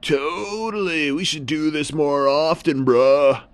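slow, loud surfer drawl; a laid-back surfer bro; surfer seal. A narrator's reading. Seal agreeing with the heron, loud surfer drawl. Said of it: "And the seal said".

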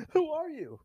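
high, soft put-on voice; frightened questioner role. Criminal asking the masked hero, high soft voice. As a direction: ask it small and high. Feeds line to Batman.

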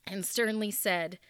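ordinary delivery

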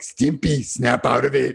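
a hint of an exasperated cartoon shout; lightly voiced Ren shaking Stimpy. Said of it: "like a Ren and Stimpy moment".

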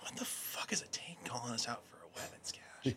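hushed, breathy disbelief; baffled whisper. Retold or imitated. Whispering to his team about the tank's odd call. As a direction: whisper it like you can't believe it.

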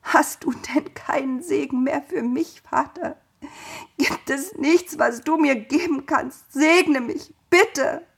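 high, pleading, tearful begging; weeping plea. A narrator's reading. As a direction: beg through tears. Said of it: "weinte dabei hemmungslos".

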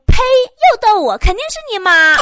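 high, loud hammed-up outburst; mock angry scolding. Current girlfriend glaring at her boyfriend, loud high mock scolding. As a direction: spit it out in shrieky mock outrage.

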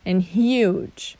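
hug and huge said slowly; slow down to contrast the vowels; slow minimal pair. Shown as a demonstration. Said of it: "more examples".

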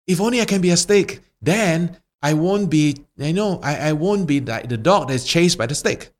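high, pleading, wishful voice; pleading dog. Acted. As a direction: beg for it, up high. Dog pleads to become stick.

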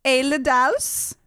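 put-on high, drawn-out names in a comic German-tinged accent; mock Swedish-German accent. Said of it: "you're really good at German accents".

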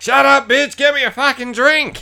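husband's pretend shouted reply to his wife, loud and high; mock shouted comeback. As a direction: bark it back in a hammed-up shout.

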